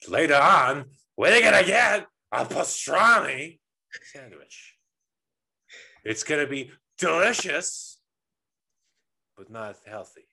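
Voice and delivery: yelled high and slow, then dropping to quiet; Al Pacino. Retold or imitated. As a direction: scream it, then suddenly go quiet. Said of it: "screaming to quiet really quick".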